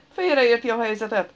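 rattled off. A narrator's reading. Injured man to his attacker, rattling it off. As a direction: blurt it out in alarm. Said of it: "hadarta a törötkező".

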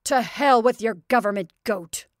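loud angry bawl. Acted. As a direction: bawl it at the neighbour. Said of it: "he bawled at Tony Kadara".

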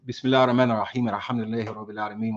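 quick, soft recited Arabic blessing; opening invocation. Real, not acted. Speaker beginning his turn with a prayer, quick soft recitation. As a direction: recite it like a formal opener.

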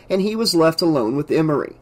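plain reading voice

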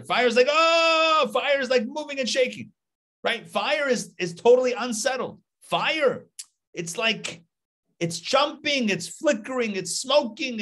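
animated, high and lively. Acted. Teacher picturing restless fire, lively and high. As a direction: pitch jumps up, lively and restless.

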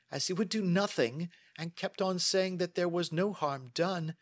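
high-pitched, aggrieved woman's voice; indignant lady client. Acted. Client complains of her father's inaction, indignant. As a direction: pour out your grievance to the detective. Miss Sutherland recounts her anger.

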